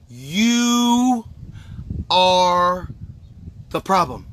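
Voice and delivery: one word at a time, cold and final; slowly, word by word. Shown as a demonstration. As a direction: hammer each word separately. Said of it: "uh, slowly".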